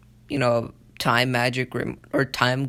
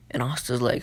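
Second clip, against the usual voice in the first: ordinary talk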